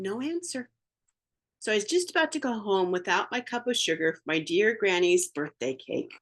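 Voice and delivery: usual speaking voice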